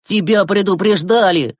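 put-on brusque boy shutting down an excuse; curt retort. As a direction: cut him off firmly. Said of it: "отрезал Глеб".

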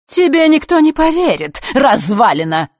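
high, loud, irritated outburst; irritated shout. A narrator's reading. Elena insults the old man who exposed her, shouting irritably. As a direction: cry out in sharp annoyance. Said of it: "раздражённо выкрикнула она".

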